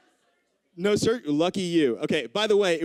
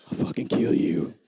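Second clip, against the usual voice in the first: low whispered mock menace; hushed threat; 6 semitones lower than usual. Threat finishes in a hushed drop.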